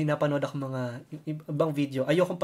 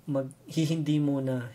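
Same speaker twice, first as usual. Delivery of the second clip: ordinary talk, nothing marked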